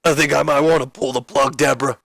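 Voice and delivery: hammed-up choked-up wrestler; holding back tears. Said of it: "he's kinda holding back tears".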